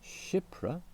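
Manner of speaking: "Shipra" lifted high. Shown as a demonstration. Teacher listing mantra parts, high lifted Shipra. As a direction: pitch "Shipra" up sharply. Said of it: "followed by".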